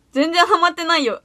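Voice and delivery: mock-tsundere brushing off a compliment. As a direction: play the tsundere who won't admit it. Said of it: "ツンデレ".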